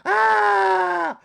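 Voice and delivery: loud high shriek; mock scream. Hit by cold shower water, loud high yelp. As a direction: let out a short sharp yelp.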